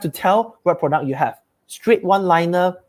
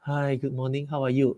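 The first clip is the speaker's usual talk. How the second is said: low, much softer, lifeless greeting; slacky voice; 4 semitones lower, 9 dB quieter than usual. Shown as a demonstration. Sales rep answering the call the wrong way, slack and soft. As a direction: sound bored and limp picking up. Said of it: "never pick up a call with a very slacky voice".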